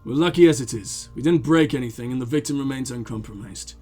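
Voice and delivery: low, measured officer voice; composed lieutenant. Acted. Lieutenant assessing the damage for a partner, composed. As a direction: state it low and controlled.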